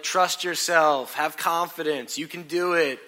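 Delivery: slow, firm coaxing; retold coaching of a nervous beginner; insistent pep talk. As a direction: press each encouragement home slowly. Retelling his pep talk on lift.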